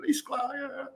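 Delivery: high, starstruck gasp of a name; blurting out a hero's name in surprise; starstruck recognition. Retold or imitated. To the famous bassist he bumped into, a high starstruck blurt. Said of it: "I'm like".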